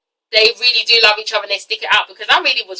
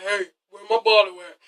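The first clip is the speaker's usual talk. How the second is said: mock grown voice; 4 semitones lower, 7 dB quieter than usual. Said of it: "I love kids with small voices".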